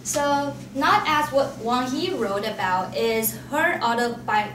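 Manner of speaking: ordinary talk, nothing marked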